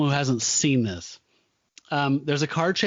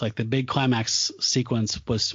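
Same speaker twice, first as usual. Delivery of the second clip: ordinary talk, nothing marked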